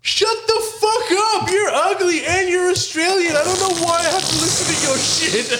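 very high-pitched mock outburst; Miss Love. Friend telling off an Australian woman, high-pitched mock indignation. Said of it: "Miss Love was like".

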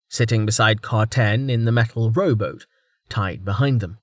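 ordinary delivery

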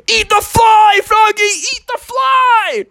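very high, loud acted yell; frantic yelling. A narrator's reading. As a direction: yell it out desperately, drawing out the end. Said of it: "his dad started yelling out".

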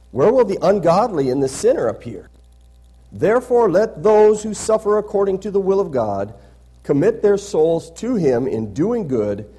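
emphatic pulpit reading, easing down at the close; scripture reading. Acted. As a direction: proclaim the verses firmly to the congregation. Reads scripture aloud to congregation.

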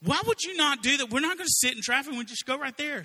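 high, loud mock-exasperated pleading; hammed-up husband, baffled she won't turn. Max arguing with his wife, high mock exasperation. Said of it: "And he says".

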